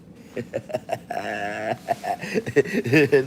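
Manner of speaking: long cackling laugh; real laugh. Real, not acted. Mocking what Adam pays, long laughing fit. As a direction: laugh long at your own punchline. Laughs at his own punchline.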